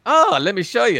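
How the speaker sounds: eager bluff, pitched high; Kirk. Retold or imitated. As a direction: lightly play a hustler offering to demonstrate. Retelling Kirk offering the rules.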